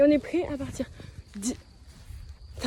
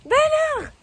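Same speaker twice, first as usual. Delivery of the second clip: high drawn-out cry of despair; dramatic wail; 5 dB louder than usual. Real, not acted. Calling out to horse again.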